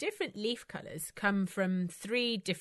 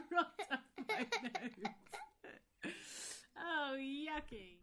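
laughing disgust; 7 semitones higher, 10 dB quieter, much slower than usual. Real, not acted. Water went up her nose.

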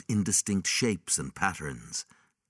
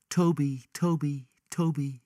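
put-on high child's voice calling a name; 9 semitones higher than usual. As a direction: call the dog's name gently, high.

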